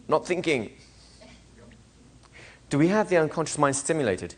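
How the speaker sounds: usual speaking voice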